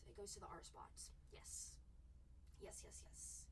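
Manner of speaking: soft whispered aside; hushed aside while running the auction. Real, not acted. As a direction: whisper it to yourself.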